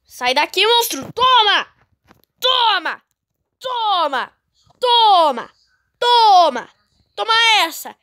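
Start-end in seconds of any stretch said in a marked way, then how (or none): 1.1-8.0 high punchy taunts; excited. Real, not acted. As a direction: taunt each hit. Striking monsters repeatedly.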